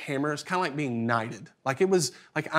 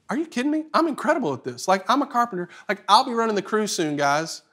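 much higher, thrilled, bragging; giddy rush of pride at his own skill; cocky excitement; 6 semitones higher than usual. Retold or imitated. Boy nailing the floor brags to himself, giddy excitement. Sudden swell of confidence.

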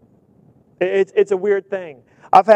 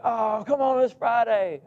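lightly voiced whiny kid, a bit higher; mock complaint from a kid; whining kid; 6 semitones higher, 4 dB quieter than usual.

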